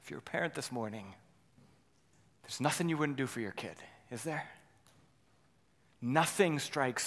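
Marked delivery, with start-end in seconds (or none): 0.0-4.5 tender aside. Acted. Softens to address parents.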